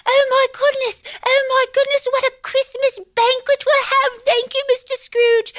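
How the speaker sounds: family thanking Scrooge for the feast; delighted Cratchits. Retold or imitated. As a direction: gush in a high falsetto. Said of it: "everyone was around the table like".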